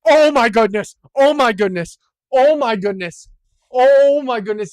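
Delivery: loud, amazed outburst; thrilled and loud; astonished exclaiming. Real, not acted. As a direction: shout it in disbelief, hitting the OH. Exclaims at near-perfect score.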